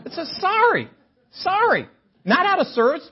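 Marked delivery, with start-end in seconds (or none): 0.4-1.9 mock apology. Mock bus sign apologizing.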